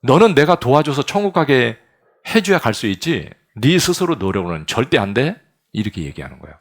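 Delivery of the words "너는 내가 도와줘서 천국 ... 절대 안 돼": a touch louder, firm and commanding; preacher voicing God, firmly and a little louder; God's voice. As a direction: speak as God laying down the truth. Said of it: "하나님은 뭐라고 하냐면".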